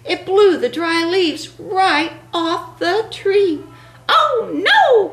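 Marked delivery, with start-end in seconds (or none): 4.1-5.1 high panicked squawk; frightened cry. Acted. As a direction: cry out in alarm. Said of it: "cried the turkey".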